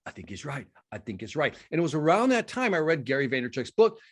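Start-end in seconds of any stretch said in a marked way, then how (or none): 0.0-1.5 quiet inner voice. Retold or imitated. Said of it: "the hobgoblin of the mind just starts gnawing at me".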